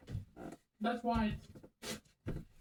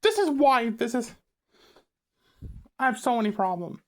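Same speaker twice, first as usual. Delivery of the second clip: loud exasperated outburst; frustrated rant; 3 semitones higher, 7 dB louder than usual. Real, not acted. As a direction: vent it, fed up and loud. Exasperated rant about annoying people.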